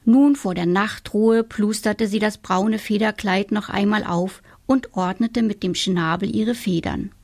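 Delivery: ordinary delivery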